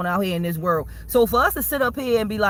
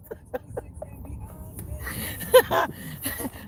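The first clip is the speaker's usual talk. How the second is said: soft high laugh; 9 semitones higher, 6 dB quieter than usual. Real, not acted. Laughing as he signs off.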